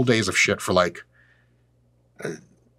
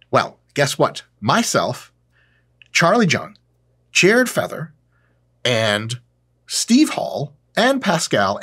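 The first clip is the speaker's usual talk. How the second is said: normal talking voice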